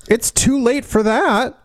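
high, pushy mock-boss voice; mock exasperated dismissal. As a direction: brush off the latecomer, hammed-up. Mocking a late objection in a meeting.

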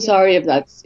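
usual speaking voice

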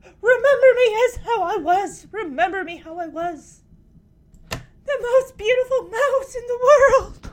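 milk the farewell like a diva; mock dying speech. Kimmy's dying speech to the audience, laughing melodrama. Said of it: "hand out to the audience".

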